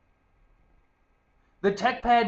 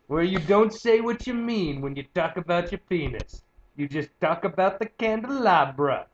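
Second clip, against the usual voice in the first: ordinary delivery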